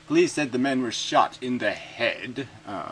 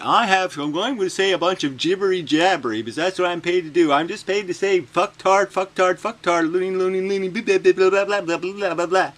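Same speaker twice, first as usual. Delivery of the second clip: higher, louder babbling mock voice; mock official; 9 semitones higher, 7 dB louder than usual. Mocking the public advocate's statement in a high babble. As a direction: parody a droning official. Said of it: "said in a statement".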